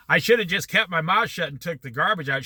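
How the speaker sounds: ordinary talk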